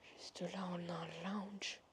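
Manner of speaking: hushed exploring whisper; nervous night vlog whisper. Real, not acted. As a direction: whisper so nobody hears you. Exploring abandoned hotel, whispering.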